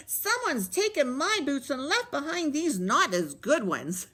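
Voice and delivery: high complaining little cub; upset baby bear. A narrator's reading. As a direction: whine it up high as a small bear. Baby Bear finds boots gone.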